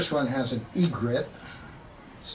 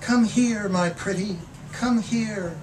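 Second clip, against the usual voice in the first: higher, louder coaxing call; 9 semitones higher, 5 dB louder, slower than usual. Acted. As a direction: call it out like a bird luring someone close. Bird calling out in poem.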